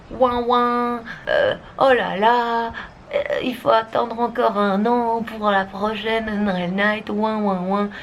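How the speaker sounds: whiny, drawn-out moaning; mock whining. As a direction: play the sulky complainer, slowly. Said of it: "je serais peut-être en mode".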